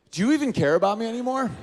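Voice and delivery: lightly voiced girlfriend starting a fight; upset girlfriend. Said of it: "she just goes".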